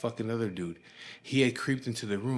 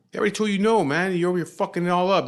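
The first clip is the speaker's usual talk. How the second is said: loud, high, irritated retelling; exasperated scolding, pitched up and louder; kinda pissed off; 8 semitones higher, 7 dB louder, faster than usual. Retold or imitated. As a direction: act out telling a friend off, raised voice. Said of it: "I'm kinda pissed off".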